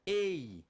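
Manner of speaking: EI as a long, falling "ay"; vowel sound demo. Shown as a demonstration. Teacher answering own pronunciation question, drawn-out vowel. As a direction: sound out "Ei" clearly and stretched. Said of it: "Qual é a pronúncia que eu tenho?".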